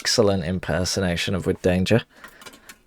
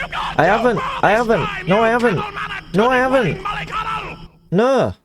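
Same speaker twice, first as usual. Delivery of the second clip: squeaky high voice; 13 semitones higher than usual. Acted. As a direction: shriek it up in a high falsetto. High-pitched shouted denial in character.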